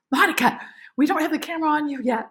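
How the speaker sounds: high, loud, fast yelling; urgent high-pitched yell to the actress; retold director yell. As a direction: shout it across the set like a director. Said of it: "he yells".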